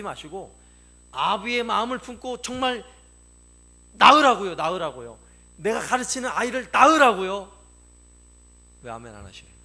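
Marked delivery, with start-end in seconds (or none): 3.9-4.5 loud preaching exhortation. Real, not acted. Preacher to congregation, exhorting them to bear spiritual children, in a loud shout. 5.6-7.5 louder, stressing 낳으라고요 strongly; loud preaching. Real, not acted. Preacher urging Sunday school teachers to bear children in faith, loudly.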